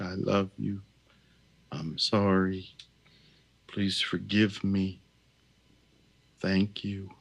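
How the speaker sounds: almost to yourself, gently; soft, inward prayer. Shown as a demonstration. Healer repeating the phrases a last time, soft inward prayer.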